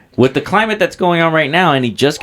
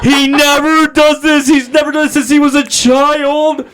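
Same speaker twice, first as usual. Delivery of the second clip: high-pitched mock relative; 10 semitones higher, 3 dB louder than usual. Relating a relative's alarm about dad, high and frantic. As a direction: act out a worked-up relative, high.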